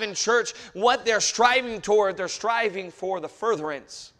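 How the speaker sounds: loud, high, driving pulpit delivery; fervent shouted sermon; full-force preaching. Real, not acted.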